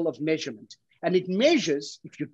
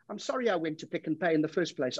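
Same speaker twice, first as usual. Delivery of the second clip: ordinary talk, nothing marked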